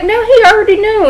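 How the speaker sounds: very high-pitched denial; high mock-indignant correction; imitated protest. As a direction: protest the accusation as the client, hammed-up. Client protests police accusation.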